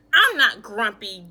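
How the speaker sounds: loud, grumpy insistence; a grumpy chimp flatly denying it, loudly; insisting. Acted. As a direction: snap back that he's not grumpy. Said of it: "Jim insisted".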